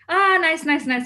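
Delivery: quick, careless, cheerful brush-off; breezy dismissive. Retold or imitated. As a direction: wave it off without listening. Partygoer brushing off bad news.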